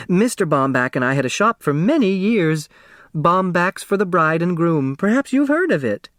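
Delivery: put-on a small, round seamstress selling her old shop; eager dressmaker.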